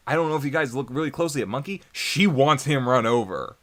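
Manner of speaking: normal talking voice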